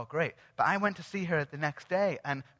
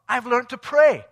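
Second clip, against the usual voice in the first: loud, high announcement; retold excited news; 5 semitones higher, 11 dB louder than usual. Woman phoning the speaker with news, excited. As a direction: imitate her proudly blurting out her news.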